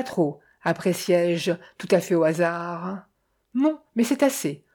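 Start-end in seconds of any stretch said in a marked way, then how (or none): 3.5-4.6 firm, higher-pitched verdict; sudden firmness. A narrator's reading. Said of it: "avec une soudaine fermeté".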